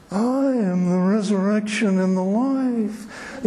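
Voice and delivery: slow, soft, breathy mock actor; soft breathy Jesus voice. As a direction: coo it like a wimpy actor. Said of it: "in this soft, breathy voice".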